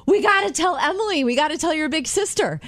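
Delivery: high, eager burst of news; bursting to share good news; excited mom. Retold or imitated. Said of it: "they're like".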